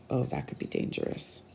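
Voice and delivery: hushed warning whisper; soft worried whisper. Real, not acted. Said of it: "whispered warning aside".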